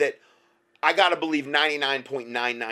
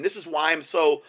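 ordinary talk

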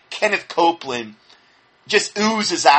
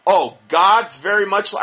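normal talking voice

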